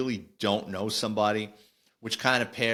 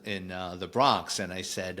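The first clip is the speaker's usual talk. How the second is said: ordinary delivery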